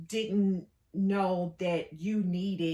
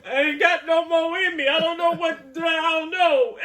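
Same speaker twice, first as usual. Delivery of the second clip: high, loud, laughing "I ain't got no more"; laughing mock plea; 10 semitones higher, 10 dB louder than usual. Wife laughing while quoting husband's helpless excuse. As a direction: plead through big laughter. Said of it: "I was like".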